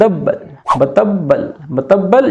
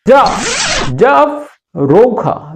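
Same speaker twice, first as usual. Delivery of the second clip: slow demo; 6 semitones higher, much slower than usual. Shown as a demonstration. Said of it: "रूखा यानी कि dry".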